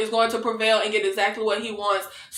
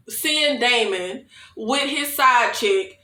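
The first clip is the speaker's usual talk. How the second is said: ordinary talk, nothing marked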